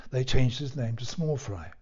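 usual speaking voice

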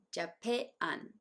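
"ジャパン" broken into "ja-peh... an"; native pronunciation. Shown as a demonstration. Teacher breaking it down, "ジャパン" as "ja-peh, an". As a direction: split the second vowel of "ジャパン", pause before "an". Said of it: "ジャパンの二番目のAも".

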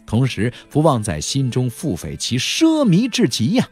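ordinary delivery, nothing marked

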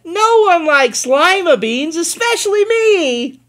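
high, loud, slow and stubborn; defiant disgust. Acted. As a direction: insist you hate them. Girl denying, high loud slow.

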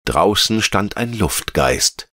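ordinary delivery

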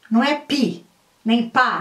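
ordinary delivery

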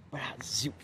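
whispered Brasil, no voice at all. Real, not acted. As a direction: breathe "Brasil" out as a soft whisper. Whispered answer to the prompt. (sussurrando "Brasil")

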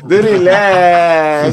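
De relax stretched very slow and loud; drawn out. Shown as a demonstration. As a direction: draw out De relax syllable by syllable, savouring it. Repeating catchphrase, drawn out.

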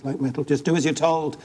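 ordinary delivery

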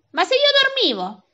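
very high, loud objection; acted flustered self-defence; indignant protest. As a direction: protest in outraged disbelief. Accused man protests to railway staff.

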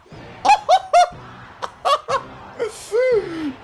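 shrill gloating laugh with a sob-like edge; high cackling laugh. Real, not acted. Gamer laughing at his rival's misfortune, high cackle. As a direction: cackle at the opponent's defeat. Opponent's loss amuses him.

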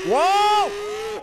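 high, long exclamation; startled whoa. Real, not acted. Player finding it scary, startled shout. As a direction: jump with a drawn-out high cry.

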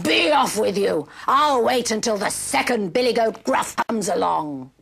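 rough, growly troll dismissal. Acted. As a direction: growl it as a troll sending someone off. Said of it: "said the troll".

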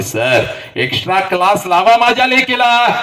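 high, urgent appeal, acted; pleading father. Father asking teacher for his daughter's extra class, high pleading. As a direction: call it out high, climbing to the end.

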